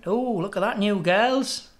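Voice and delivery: lightly voiced parrot eyeing the newcomers; joke parrot. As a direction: lift the "oh" with nosy interest. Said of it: "and the parrot goes".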